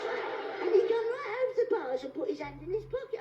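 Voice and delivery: usual speaking voice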